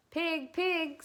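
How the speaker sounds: kind, high calling voice. A narrator's reading. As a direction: coax the pig kindly. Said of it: "in a kind voice".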